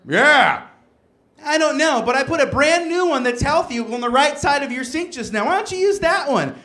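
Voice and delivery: high, sing-song wife voice; mock wife voice. Wife explaining the healthy toothpaste swap, high mock voice. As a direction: act out the sweetly suspicious wife. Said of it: "she's like".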